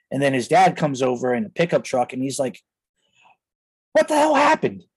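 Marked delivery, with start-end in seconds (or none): 3.9-4.8 startled father's voice, jumping way higher; a dad pulling up, shocked at the sight. Retold or imitated. Friend's dad arrives, shocked.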